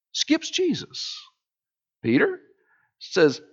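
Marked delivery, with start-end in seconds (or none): 0.1-1.2 high, soft and slow, like skipping over waves; light and airy. Acted. Preacher painting Jesus on the waves, high and soft. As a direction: float the name up lightly.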